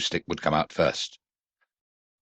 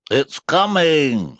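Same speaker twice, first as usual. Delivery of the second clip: higher, slow bear voice; Pooh's voice; 10 semitones higher, 5 dB louder, much slower than usual. A narrator's reading. Pooh watching the stick arrive, slow high voice. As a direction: announce it slowly. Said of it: "said Pooh".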